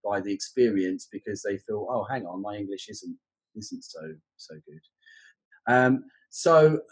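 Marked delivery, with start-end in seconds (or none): 1.9-5.7 soft, slow, discouraged student voice; mildly acted dejection, trailing off softly; deflated. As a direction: let it sink away, quietly disheartened. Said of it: "feel very deflated".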